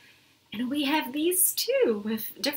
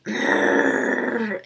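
mock mad growl; 4 semitones lower, 6 dB louder than usual. Shown as a demonstration. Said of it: "I think sometimes when I'm mad".

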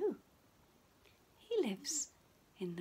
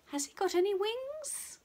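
bright question; 7 semitones higher than usual. Acted. Quizzing children in bright question voice.

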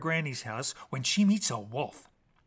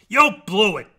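loud taunt; 4 semitones higher, 12 dB louder than usual. Acted. Wolf taunts Jack.

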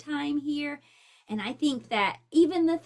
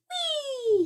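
much higher delighted whee; gleeful cry; 14 semitones higher, 3 dB louder than usual. Acted. Hawk flying loops in the wind, gleeful cry. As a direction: squeal with joy as you loop. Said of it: "he cried".

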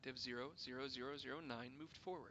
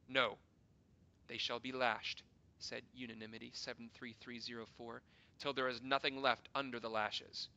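cruel loud demand; 9 dB louder than usual. Acted. Councillor demands lashing.

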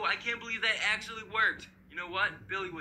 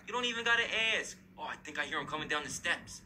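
normal talking voice

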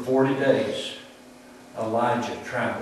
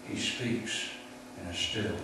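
drops to a soft near-whisper on the phrase; hushed; 5 semitones lower, 14 dB quieter than usual. Shown as a demonstration. Preacher showing God's small voice, hushed. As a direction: let the words shrink to almost nothing. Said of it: "still, small voice".

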